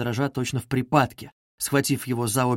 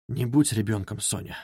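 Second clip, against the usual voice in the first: very soft, lower, fading on Соня; quietly; 5 semitones lower, 6 dB quieter than usual. Acted. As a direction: say it softly, almost under the breath. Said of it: "тихо проговорил он". (тихо, почти шёпотом, всё тише к «Соня»)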